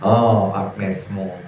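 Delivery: usual speaking voice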